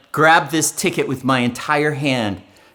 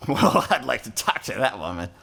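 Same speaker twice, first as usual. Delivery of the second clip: smitten man thinking; 4 semitones lower, 5 dB quieter than usual. Acted. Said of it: "man says to himself".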